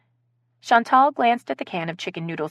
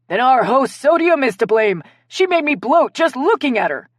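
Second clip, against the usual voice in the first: higher, louder theatrical character voice; husky improv character; 7 semitones higher, 6 dB louder than usual. A narrator's reading. Dinner guest blames the host at table, husky character voice. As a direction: put on a big, accusing character voice. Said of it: "who used a husky voice for her character".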